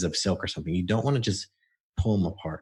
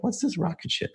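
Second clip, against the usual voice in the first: curious; 10 semitones higher than usual. Retold or imitated. Asking client about drawing.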